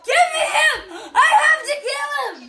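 high, frantic shouting; frantic demand. Real, not acted. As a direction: yell it high, desperate for the kill. Demands the kill mid-game.

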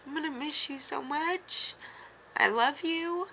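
high, tearful goodbye; tearing up. Real, not acted. Saying goodbye to the baby who is moving, tearing up. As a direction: say it through real tears, voice climbing high. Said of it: "tearing up like I am now".

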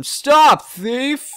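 loud, high call after him. Retold or imitated. Peddler recalls shouting after the thief, loud call. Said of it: "I called after him".

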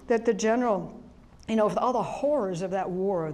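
normal talking voice

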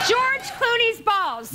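slow, deliberate punchline. Acted. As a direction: savor each word. Game answer.

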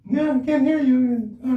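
high, sweet angel voice; little angel voice. Real, not acted. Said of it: "with my voice like this all the time".

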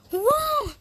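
high, loud burst of wonder; amazed exclamation. Acted. Character feels good with new leg.

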